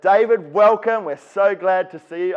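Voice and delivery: high, loud, gushing welcome; overjoyed deputy. Retold or imitated. Deputy principal gushing a welcome at the new teacher, high and loud. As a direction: gush like a relieved deputy.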